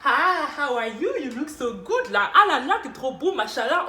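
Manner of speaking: loud, swooping mock flattery; gushing flirty greeting. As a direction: gush over them with exaggerated delight. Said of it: "Genre, je viens".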